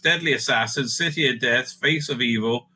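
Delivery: usual speaking voice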